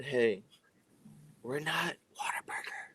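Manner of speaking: whisper. Retold or imitated. Aside whisper joke about restaurant.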